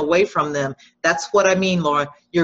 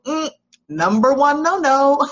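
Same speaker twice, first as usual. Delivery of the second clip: higher, laughing no, no; amused rejection; 8 semitones higher, 3 dB louder than usual. Real, not acted. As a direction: brush it off with a laugh in the voice. Rejecting "I know how you feel.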